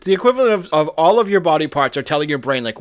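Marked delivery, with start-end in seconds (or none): none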